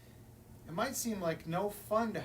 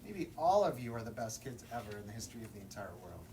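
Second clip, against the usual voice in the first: quick low aside; 8 semitones lower, faster than usual. Acted. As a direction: lower and fast aside. Lower faster aside to all.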